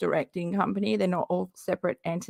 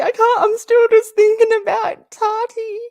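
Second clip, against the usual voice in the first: much higher, louder, squealing; excited squeal; 14 semitones higher, 9 dB louder than usual. Real, not acted. Reacting to Katie's drama, high excited squeal. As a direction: squeal it in disbelief.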